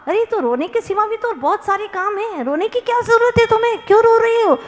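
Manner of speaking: high-pitched nagging neighbour. Acted. Neighbour questions the weeping man.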